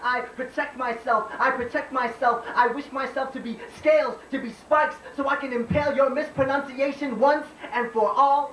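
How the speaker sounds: spoken-word poem. Acted. Poet tells America of a mother's hands, declaimed spoken-word poem.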